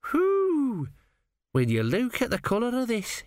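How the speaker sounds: high, wide-eyed lad marvelling; the apprentice marvelling at an organ's colour; amazed whistle. A narrator's reading. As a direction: gasp at what you've found. Gurn amazed.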